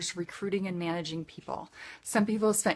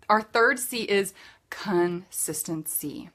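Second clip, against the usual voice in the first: normal talking voice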